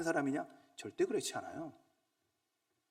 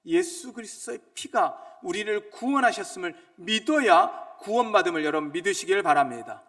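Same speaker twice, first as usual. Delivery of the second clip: loud, high, insistent sermon cry; fervent preacher proclaiming; preaching at full force; 6 semitones higher, 13 dB louder than usual. Acted. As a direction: drive it home to the congregation.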